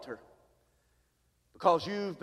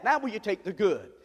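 usual speaking voice

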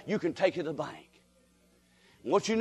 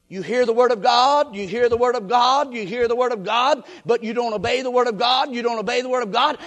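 insistent preaching refrain; 2 semitones higher, 5 dB louder than usual. Real, not acted. Repetitive preaching.

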